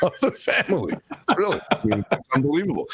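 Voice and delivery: amused and delighted; laughing. Real, not acted. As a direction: chuckle fondly as you talk. Gushing about beloved dog Moose.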